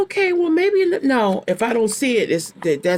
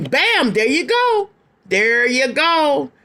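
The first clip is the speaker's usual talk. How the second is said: excited; 5 semitones higher, slower than usual. Real, not acted. Card confirms her reading.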